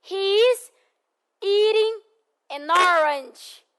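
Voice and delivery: "He is eating an orange" slow, with a tapped t in "eating"; slow model sentence. Shown as a demonstration. Teacher models the sentence slowly to be repeated. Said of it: "só mais uma vez pra gente trabalhar a pronunciation".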